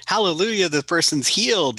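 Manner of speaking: high, hammed-up preacher cry; imitating church healer. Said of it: "you know, like".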